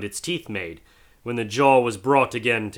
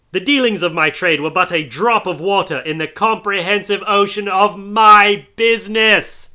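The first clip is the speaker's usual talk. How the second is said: high, loud, slow ghostly wail; tormented ghost; 11 semitones higher, 7 dB louder than usual. Acted. Marley's ghost crying out his remorse to Scrooge. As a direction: hurl each word out in anguish.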